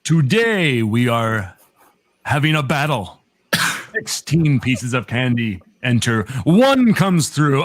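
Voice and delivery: slow, spooky vampire count voice; Transylvanian accent. Acted. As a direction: roll out a Transylvanian count welcome. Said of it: "You did a nice count voice".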